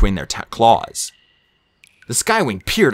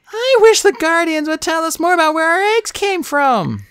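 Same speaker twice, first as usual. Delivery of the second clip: high character voice, lightly sighing; wistful sigh. A narrator's reading. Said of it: "Sunny said with a sigh".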